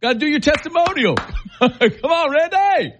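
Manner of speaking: high, squeaky teasing call to Randy; mock pleading call. Host teasing a listener to give a testimonial, high squeaky coaxing. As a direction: pitch way up and coax Randy, laughing. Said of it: "Randy wanted to do a testimonial".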